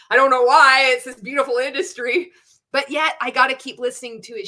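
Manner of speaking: laughs loudly, mocking her old self; sarcastic laugh. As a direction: burst out laughing at how blind she was. Mocking her old cult mindset.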